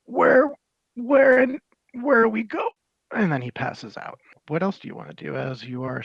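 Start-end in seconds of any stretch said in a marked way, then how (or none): 0.0-2.7 high, loud, bewildered cry; dazed, high, lost. Acted. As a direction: call out, lost and confused. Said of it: "he just says".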